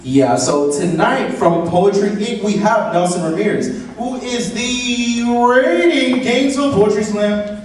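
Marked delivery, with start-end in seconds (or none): none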